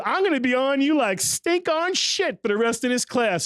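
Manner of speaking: lightly put-on stern chef; imitating Chef Clark. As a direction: mimic a tough instructor laying down the law. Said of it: "He goes".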